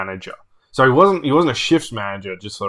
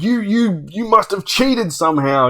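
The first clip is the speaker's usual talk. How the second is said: high, indignant mock sputter; retold accusation; 9 semitones higher than usual. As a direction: imitate a sore loser accusing his opponent. Retold sore loser's accusation.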